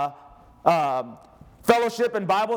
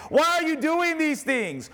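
crying out; 8 semitones higher than usual. A narrator's reading. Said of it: "they were crying out, saying".

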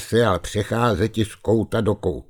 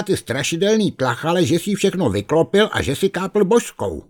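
put-on higher, lively scolding voice of a young bear; scolding bear cub; 7 semitones higher than usual. Kubula scolds the ghost Barbucha, high and reproachful. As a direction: tell the ghost off, pitched up.

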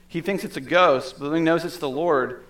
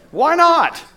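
much higher and louder, tossed-off; excited why not; 14 semitones higher, 10 dB louder than usual. Real, not acted. Student pilot offers a flight, speaker accepts with a gleeful shout. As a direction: go for it, a reckless burst. Agreeing to a reckless plane ride.

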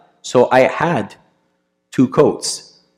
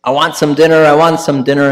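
retold shouting; 5 semitones higher, 4 dB louder than usual. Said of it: "she's just shouting".

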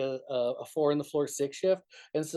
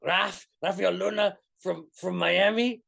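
put-on a light take on a thick Spanish accent, slow and raised; slow, higher name-giving in a thick Spanish accent; imitating thick Spanish accent; 5 semitones higher, 4 dB louder, slower than usual. Singer giving his name to the cop in a thick Spanish accent. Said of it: "had the thickest Spanish accent".

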